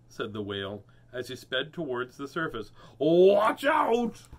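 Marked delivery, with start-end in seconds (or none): 3.0-4.3 high, loud, drawn-out warning; warning shout. Acted. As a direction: holler a warning while surging upward. Whale warns while speeding up.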